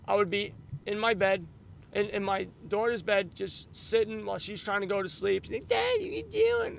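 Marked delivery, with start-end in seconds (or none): 5.7-6.8 high child voice, imitating daughter; little daughter voice. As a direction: squeak it like a sleepy kid.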